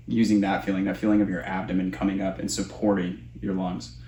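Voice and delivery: normal talking voice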